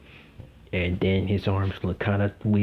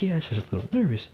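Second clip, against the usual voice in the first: higher, quicker lightly voiced nervous girl; 7 semitones higher, faster than usual. Teller voicing Uraraka admitting nerves, higher and quick. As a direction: toss it off high and quick, a little nervous.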